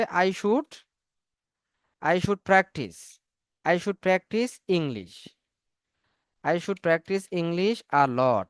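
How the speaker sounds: very slow, building the phrase word by word; slow dictation. Shown as a demonstration. Teacher dictating an English sentence to the class, very slowly. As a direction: lay each word down for students to write. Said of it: "builds the sentence word by word".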